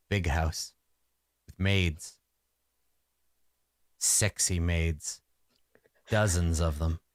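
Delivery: lightly voiced sorcerer lost in low, halting reminiscence; rambling sorcerer. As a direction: drift through hazy memories, pausing often. Plays rambling old sorcerer.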